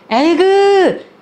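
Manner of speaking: high, long-drawn exasperated groan; exasperated tut. Acted. Grandmother from the neighbourhood reacts to a naive answer, exasperated. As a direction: draw it out high in disapproval. Old woman tuts in disapproval.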